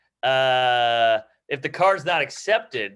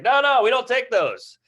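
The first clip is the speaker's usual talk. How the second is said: higher, louder mock cashier saying no; mock cashier refusal; 9 semitones higher, 4 dB louder than usual. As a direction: play the cashier turning him down, pitched high.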